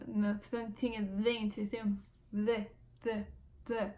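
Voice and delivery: "z" with the tongue on the front teeth, slow; slow, soft "z" held at the teeth; TH sound demo. Shown as a demonstration. Explaining the TH sound to viewers, slow and soft. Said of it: "esse som que você põe a língua".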